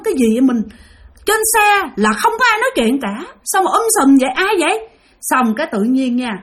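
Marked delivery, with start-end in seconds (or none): 0.0-4.9 high, uneasy questions; scared bus driver asking who is talking, higher pitched; frightened driver. Retold or imitated. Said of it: "Ông nói".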